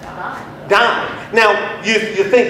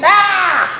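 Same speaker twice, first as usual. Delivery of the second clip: sudden sharp high cry of a dying man; death cry; 13 semitones higher, 4 dB louder than usual. Acted. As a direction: let out a short dying cry. Dying cry after quoting Jesus.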